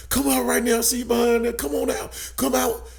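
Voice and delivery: high-pitched cop calling out orders; high, insistent police command, not full volume; retold cop yelling. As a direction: imitate a cop calling a kid out. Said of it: "you know, he was yelling".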